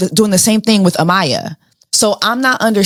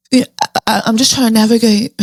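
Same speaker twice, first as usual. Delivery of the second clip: lightly mocking imitation of Sierra's pouty lip-stuck talk; Sierra impression; 3 semitones higher, faster than usual. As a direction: mimic her lips-stuck way of speaking. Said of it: "why do Sierra talk like that?".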